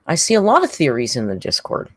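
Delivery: normal talking voice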